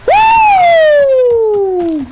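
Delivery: long high whoop of triumph; loud whoop. Real, not acted. Gamer whooping as he clears the jump, loud. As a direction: cheer it out at full voice.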